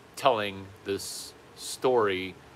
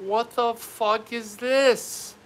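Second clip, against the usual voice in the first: high, drawn-out incredulous question; hammed-up exasperation; exasperated disbelief; 12 semitones higher, 3 dB louder, much slower than usual. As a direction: stretch the words out in mock outrage. Acted disbelief at the crying.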